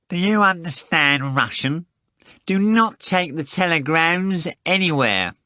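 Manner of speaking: high-pitched, insistent nasal order; disgusting nasal voice. A narrator's reading. Phone caller repeating the telegram warning, repulsively nasal. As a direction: spell out the warning in a nasty nasal voice. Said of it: "the same disgusting voice replied".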